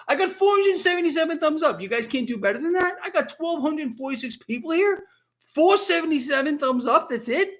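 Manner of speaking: high, hammed-up disbelief at the viewers; mock outrage. Host teasing viewers about too few thumbs, mock indignant. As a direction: tease the chat with playful indignation.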